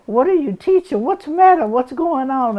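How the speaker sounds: high, loud, alarmed questions; alarmed mother. Retold or imitated. Mother storming up to the gardener, high alarmed questions. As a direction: fire them off in a panic. Mother confronting Mister Lonnie.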